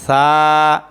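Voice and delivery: সা held low and clear; model letter. Shown as a demonstration. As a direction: lead repetition of সা. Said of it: "আবার বলেন". (সা স্পষ্ট করে বলা)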